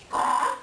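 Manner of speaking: sudden high bird cry, caught off guard; startled squawk. Acted. Raven squawks in alarm at the elder's words. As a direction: let out a sharp startled squawk.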